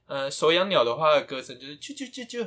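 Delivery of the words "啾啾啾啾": softer, quick chirps; little budgie tweets; happy bird chirping. Retold or imitated. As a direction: lightly imitate a happy songbird. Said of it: "很开心的".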